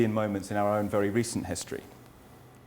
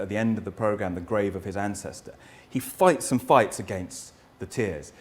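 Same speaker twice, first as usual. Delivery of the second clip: usual speaking voice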